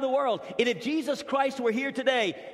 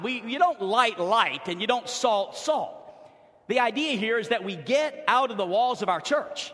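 usual speaking voice

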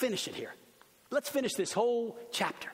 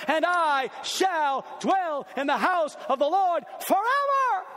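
full-force preaching; 7 semitones higher, 5 dB louder than usual. Acted. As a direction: proclaim it to the back row.

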